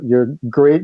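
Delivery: ordinary talk, nothing marked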